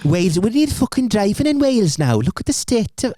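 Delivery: put-on Welsh accent. Asked for Welsh, a high sing-song Welsh accent. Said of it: "Can you do Welsh?".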